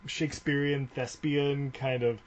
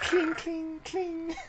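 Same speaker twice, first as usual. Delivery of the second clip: much higher, clanging "cling" sounds; sword clang noises; much slower than usual. Pretend. Joking about a movie battle, high mock clang noises. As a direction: ring out each "cling" like clashing metal. Imitating swords clashing in fantasy scene.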